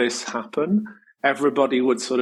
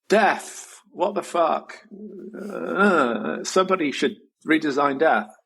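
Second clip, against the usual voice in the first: pitched-up outburst at death; exasperated outcry; 5 semitones higher, slower than usual. Retold or imitated. Speaker calling out publicly to the world, pitched-up exasperation. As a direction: throw the question out in disbelief.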